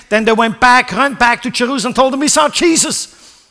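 high, loud preaching build; fiery preaching. Acted. Preacher driving the story home to the congregation, fiery. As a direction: preach it out to the church.

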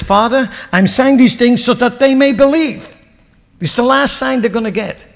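loud, high proclamation; preaching at full force. Acted. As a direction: lift it up to the Father.

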